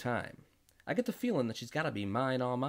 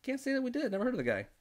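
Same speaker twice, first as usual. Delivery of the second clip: high voice; 11 semitones higher than usual. Retold or imitated. The husband answers the newcomer in a raised high voice. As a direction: voice pitched well up, light and quick. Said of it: "And I said".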